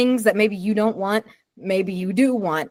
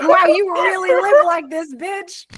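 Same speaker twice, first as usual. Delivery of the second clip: punk guy voice; 10 semitones higher than usual. Retold or imitated. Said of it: "you're like".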